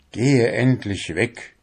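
usual speaking voice

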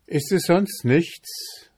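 high-pitched, slow, lightly called question. A narrator's reading. Boy answers the king's new test, lightly calling out. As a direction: toss it back high, with a mild call. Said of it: "rief der Bursche".

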